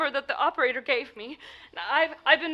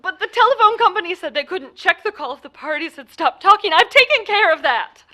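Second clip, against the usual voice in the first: louder and higher, pressing the point; loud insisting; 3 semitones higher, 8 dB louder than usual. Acted. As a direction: push back hard at the doubting sergeant. Caller insisting to a doubtful sergeant.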